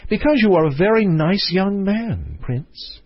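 a touch of a coy fairy-tale princess; theme park princess. A narrator's reading. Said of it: "said the princess".

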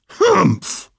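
high snorty camel humph; grumbling camel voice. Acted.

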